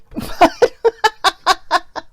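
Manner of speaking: loud, high burst of laughter. Real, not acted. Vlogger laughing at her siblings' imagined retort, loud burst of laughter. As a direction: laugh hard at her own joke.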